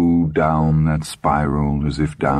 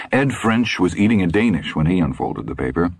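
plain reading voice

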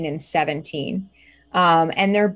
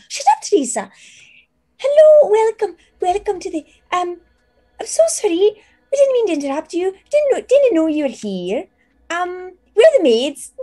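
very high, loud startled apologies; a maid caught out, gushing sorry; flustered teen maid; 13 semitones higher, 5 dB louder than usual. Acted. GM voicing a startled maid apologising to the guests, very high.

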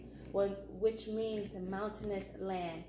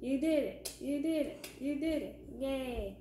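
cheering praise; 5 semitones higher than usual. Real, not acted. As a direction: cheer the little one on. Praising a child's finished craft.

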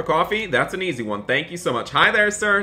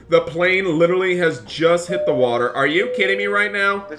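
ordinary talk

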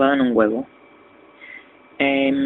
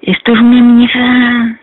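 high, loud, slow frustrated yell; angry shout; 8 semitones higher, 10 dB louder than usual. Real, not acted. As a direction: bellow it at the game. Game frustration outburst.

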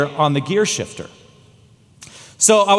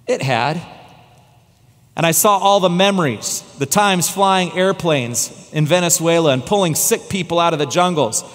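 ordinary talk

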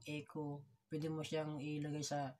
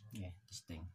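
mic test through a deep voice changer; deep pitch shift; 9 semitones lower, 7 dB quieter than usual. Shown as a demonstration. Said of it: "Susubukan natin".

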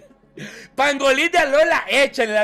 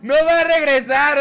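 laughing; 3 semitones higher, 4 dB louder than usual. Real, not acted. Laughing at a chat claim.

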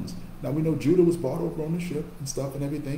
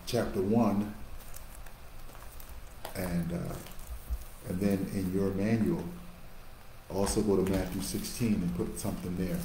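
ordinary delivery

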